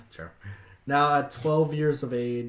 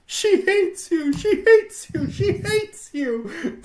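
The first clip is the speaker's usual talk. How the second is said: very high, loud, slow sing-song taunt; a shrill mocking voice chanting in her head; Film Brain voice; 7 dB louder, slower than usual. Reading a creepy story to friends, voice in Fifi's head taunting high. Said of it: "if fucking Film Brain was talking to me".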